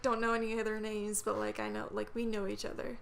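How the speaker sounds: normal talking voice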